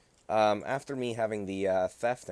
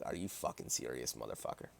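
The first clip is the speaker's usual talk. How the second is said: muttered disbelief; 8 semitones lower, 13 dB quieter than usual. Retold or imitated. Reaction to theft charge, muttered.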